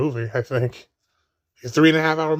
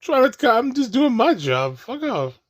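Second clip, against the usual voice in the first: high mock complaint; 9 semitones higher than usual. Voicing the put-upon Obi-Wan.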